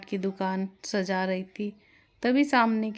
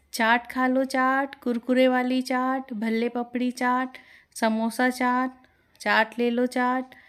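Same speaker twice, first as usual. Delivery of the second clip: calling out loud; 3 semitones higher than usual. Acted. Neeta calling customers to her chaat stall, loud hawker's cry. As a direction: sing out the wares to passers-by. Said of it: "ज़ोर-ज़ोर से आवाज़ लगाकर".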